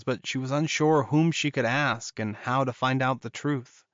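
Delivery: ordinary delivery, nothing marked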